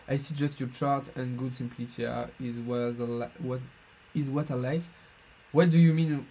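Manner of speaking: halting English with a thick French accent. Real, not acted. Streamer answering a viewer in English, strong French accent. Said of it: "je m'excuse de mon accent absolument horrible".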